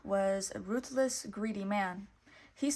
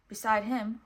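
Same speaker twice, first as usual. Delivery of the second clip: plain reading voice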